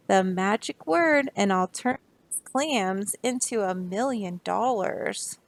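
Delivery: ordinary delivery, nothing marked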